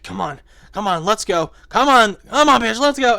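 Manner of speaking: building from eager to loud, high urging. Retold or imitated.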